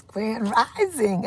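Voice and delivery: high, slow and soft; drawn-out greeting. Real, not acted.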